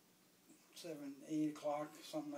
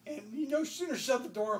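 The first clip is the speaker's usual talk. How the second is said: ordinary delivery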